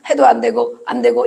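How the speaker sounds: usual speaking voice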